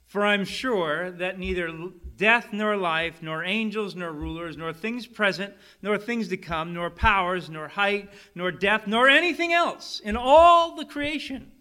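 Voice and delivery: loud, high, slow building proclamation; preaching at full force. Acted. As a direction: build the scripture to a triumphant climax. Reading Romans climax.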